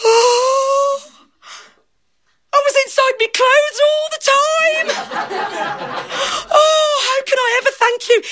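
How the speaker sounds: high, breathless joy; overjoyed gasp. Acted. Daft fella sees himself in the mirror, overjoyed.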